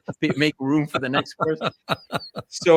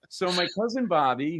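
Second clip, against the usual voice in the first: ordinary delivery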